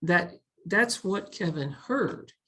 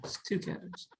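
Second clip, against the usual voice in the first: soft whispered aside; playful whisper; 2 semitones higher, 10 dB quieter than usual. Pretend. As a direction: whisper the punchline like a secret joke. Whispered joking repeat of phrase.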